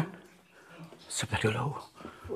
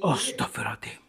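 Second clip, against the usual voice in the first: whisper; 4 semitones lower, 13 dB quieter than usual. Real, not acted. Shocked prayer at the sight.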